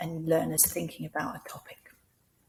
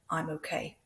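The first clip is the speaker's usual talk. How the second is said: "I'm okay" dropping softly at the end; statement tune; 8 dB quieter than usual. Shown as a demonstration. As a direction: let "I'm okay" fall, not go up. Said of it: "if it doesn't go up, it's a statement".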